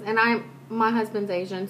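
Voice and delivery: ordinary talk, nothing marked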